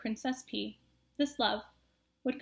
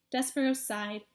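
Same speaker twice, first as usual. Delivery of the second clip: plain reading voice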